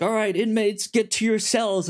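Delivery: high, announcing voice over a PA; loudspeaker announcement. Retold or imitated. Prison loudspeaker calls inmates to cells, announcer voice. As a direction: bark orders to the inmates. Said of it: "on the loudspeaker is like".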